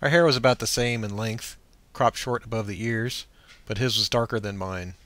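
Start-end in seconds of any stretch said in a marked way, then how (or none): none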